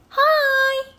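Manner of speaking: very high, loud call. A narrator's reading. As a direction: Annie calls hi down to the creature, loud high call. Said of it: "Annie called again to the creature".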